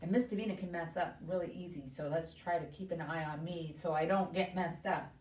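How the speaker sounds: ordinary talk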